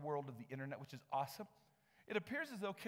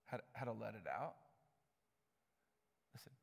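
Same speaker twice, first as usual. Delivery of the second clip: usual speaking voice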